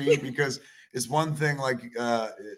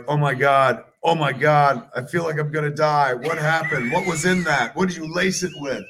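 mock panic; 3 semitones higher, 8 dB louder than usual. Wife panicking after weed.

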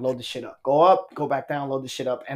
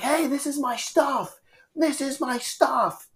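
high, slow protesting mock yell; drawn-out high voice, protesting; imitated freaked-out guy; 10 semitones higher, slower than usual. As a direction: mimic a freaked-out intruder's whine. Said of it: "freaks out".

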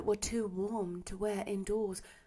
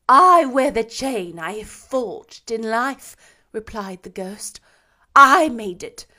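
mournful ghost; 4 semitones higher, 12 dB louder than usual. A narrator's reading. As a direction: lament like a chained spirit doomed to wander. Ghost speaks to Scrooge.

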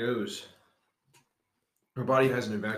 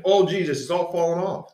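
alarmed; 9 semitones higher, 8 dB louder than usual. Real, not acted. Mic falling off, alarmed.

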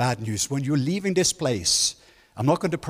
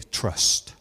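whispered word. Acted. Dramatic one-word reveal.

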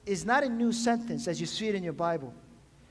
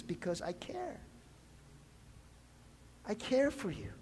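tender reassurance; 3 semitones lower, 13 dB quieter than usual. Acted. Jesus voiced tenderly to listeners.

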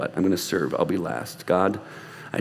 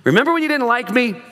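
much higher, louder voice, teasing the neighbor; ribbing an old friend in a raised voice; 12 semitones higher, 4 dB louder than usual. Retold or imitated. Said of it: "I'm like".

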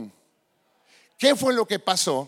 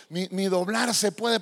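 normal talking voice

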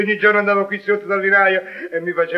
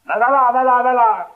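loud dismissive Emilian "va là"; italian dialect; 4 dB louder than usual. Acted. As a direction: scoff it off loudly in dialect.

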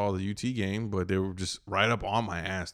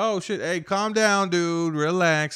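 higher, urgent calm-down; placating; 7 semitones higher, 4 dB louder, slower than usual. Retold or imitated. Driver answering a road-rager, placating. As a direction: talk down an angry driver, voice lifted. Retold plea to raging driver.

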